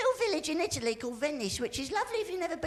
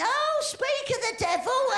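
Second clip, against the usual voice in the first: high, slow exclamation; drawn-out, high-pitched mock recognition; mock surprised greeting; 9 semitones higher than usual. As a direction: call it out in hammed-up surprise. Acts out greeting the Pope.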